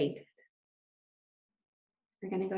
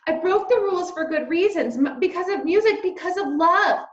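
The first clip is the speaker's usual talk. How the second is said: pleading louder and higher; raised voice; 8 semitones higher, 6 dB louder than usual. A narrator's reading. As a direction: raise the voice to plead his case. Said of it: "He raised his voice".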